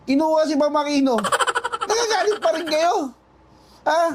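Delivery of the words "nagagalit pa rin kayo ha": half-laughing, raised jeer; mocking laugh while calling them out; laughing taunt. Real, not acted. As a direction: laugh through the jab at them. Taunting angry opponents.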